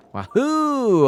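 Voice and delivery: high excited whoop; wahoo yell. Real, not acted. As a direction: cheer as you leap. Jumping off a ledge.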